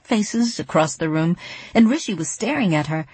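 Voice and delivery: ordinary delivery, nothing marked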